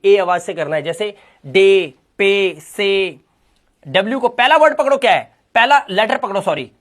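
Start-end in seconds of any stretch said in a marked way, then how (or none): 0.8-3.2 slow and careful: "डे, पे, से"; slow word demo. Shown as a demonstration. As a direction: linger on the ए sound of each word. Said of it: "उच्चारण सिर्फ ए".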